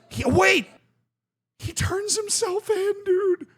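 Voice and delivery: very high, incredulous cry; astonished outburst. Real, not acted. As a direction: burst out in real disbelief. Astonished reaction to the clip.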